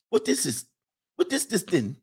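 high-pitched mock foreign accent, somewhere between African and Lithuanian; muddled Lithuanian-African accent. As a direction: mimic the girlfriend's baffled questions in a high, garbled accent. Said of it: "is this an African accent or a Lithuanian accent?".

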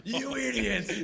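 slow, high tough-guy cop; 1930s beat cop. Pretend. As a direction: drag out the insult like a gangster. Replays the Jimmy voice.

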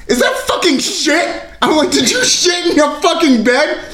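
high-pitched fake disgust and accusation; hammed-up blaming shout; mock outrage. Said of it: "I go".

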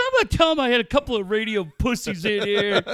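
fast, high, loud rant; losing it. Retold or imitated. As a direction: imitate an over-excited coach in a rush of words. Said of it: "Bud lost his shit".

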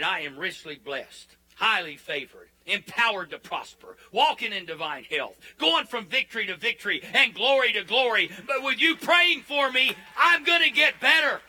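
building from forceful declarations to a high full shout; getting worked up. Acted. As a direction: work yourself up into a roaring preacher's climax. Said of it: "then I start getting on it".

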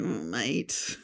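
low, soft, doubtful hum; mock reluctant. Prince having read the script, mock reluctant. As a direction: let it drop low, badly unimpressed in jest. Retold reaction to reading script.

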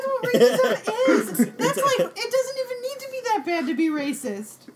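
high, loud, laughing insistence; amused indignation. Real, not acted. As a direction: argue it exasperated, through laughter. Laughing indignant argument about racism.

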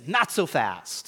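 loud, slow sportscaster call; Lee Corso catchphrase. Retold or imitated. Pastor quoting a football analyst to the congregation, loud and slow. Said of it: "Lee Corso, he says".

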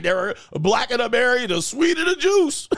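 climbing to a very high squeal; mock-desperate, rising high wail; hammed-up declaration. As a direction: push the pitch up and up to the end. Hammed-up saying by struggling man.

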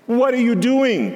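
bellowing. A narrator's reading. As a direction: boom it out, catching the boy in the act. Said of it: "Grandpa bellowed".

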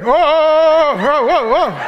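hammed-up backing-off whoas; comic recoiling panic; mock alarm. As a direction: blurt a loud, startled stream of whoas. Man recoils at period excuse.

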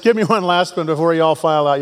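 ordinary talk

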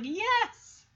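high, drawn-out cheer; celebrating boys. Acted. Dogs cheering their win over the mountain lion, mock triumphant. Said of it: "the boys are all just like".

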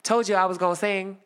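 taunting the principal with a point; pointed gloat. Retold or imitated. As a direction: jab it at her triumphantly. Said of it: "hit her with one of those points".

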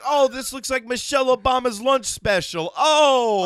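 high, loud brash comic voice; brash stand-up comic riffing; Andrew Dice Clay. Pretend. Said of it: "[name] turned into Andrew Dice Clay".

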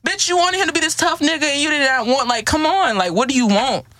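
high-pitched mock scolding; exasperated retort. Woman answering her own mimicked complainer, high exasperated retort. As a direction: call her out in a high, incredulous voice.

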